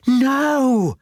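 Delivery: high anguished shout of no. A narrator's reading. Lucy shouting at the kidnapper, high anguished cry. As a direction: cry it out high as the pram tips. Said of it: "shouted Lucy".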